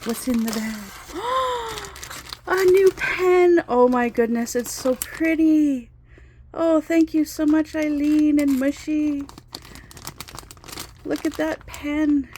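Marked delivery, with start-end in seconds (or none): 0.8-2.4 a gasp leaping way up in pitch; high delighted gasp. Real, not acted. Spotting the new pen from friends, high delighted gasp. 2.5-12.3 higher and much louder, gushing; thrilled. Real, not acted. Thanking Eileen and Mushy for the pen, loud thrilled gushing.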